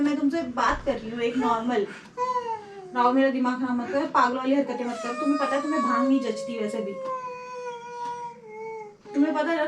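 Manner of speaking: usual speaking voice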